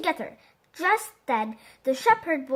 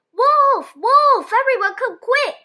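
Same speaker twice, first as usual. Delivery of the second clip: high, loud shouted alarm; yelling for help; 9 semitones higher, 7 dB louder than usual. A narrator's reading. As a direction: shout to the whole village from the treetop. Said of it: "He climbed the tree and yelled".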